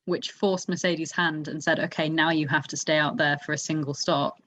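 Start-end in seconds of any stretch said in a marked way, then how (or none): none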